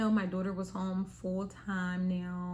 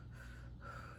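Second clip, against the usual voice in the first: nervous exhale; 17 dB quieter than usual. Acted. Covering screen, awaiting results.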